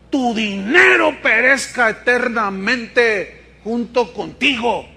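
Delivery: slow, weighty "Tu dinero perezca eternamente" with each word leaned on; preacher's slow emphatic quote; emphatic reading. Shown as a demonstration. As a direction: stretch every word to drive it home. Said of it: "yo quiero enfatizar la palabra perecer".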